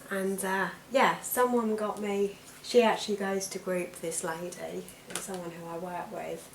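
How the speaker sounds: ordinary talk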